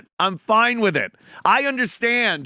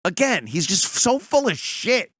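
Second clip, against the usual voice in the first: ordinary talk, nothing marked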